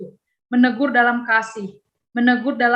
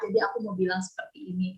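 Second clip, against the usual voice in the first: soft, lower coaxing voice; 5 semitones lower, 5 dB quieter than usual. Acted. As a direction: gently sweet-talk a friend. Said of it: "kalian rayu dia".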